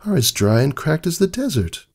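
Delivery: fretting over his late return; worried wife. A narrator's reading. Wife voiced by narrator.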